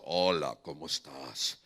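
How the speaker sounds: very low, slow booming voice of God; a playful deep divine voice greeting you. Pretend. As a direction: rumble it like God whispering in your ear. Imagined God speaking in ear.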